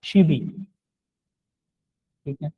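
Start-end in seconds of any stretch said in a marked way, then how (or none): 0.0-0.4 "शी बी" repeated with the b opening the second syllable; "शी बी" said as one smooth linked word; correct linked reading. Shown as a demonstration. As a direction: model "शी बी" once more, linked. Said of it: "इसका सही sound जो है वो शी बी".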